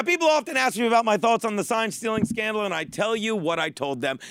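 ordinary talk

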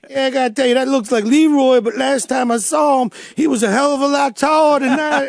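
deadpan the joke in character; clinic guy. Retold or imitated. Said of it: "And he goes".

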